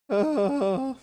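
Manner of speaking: cracking high squeak of a voice. Real, not acted. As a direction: voice breaks up high. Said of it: "my nice voice crack".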